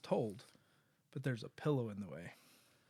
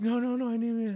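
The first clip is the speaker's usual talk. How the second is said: put-on higher, louder panicky child voice; scared little kid; 9 semitones higher, 7 dB louder, faster than usual. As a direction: protest like a kid afraid to lose his finger. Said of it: "he's like".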